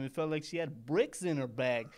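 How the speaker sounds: usual speaking voice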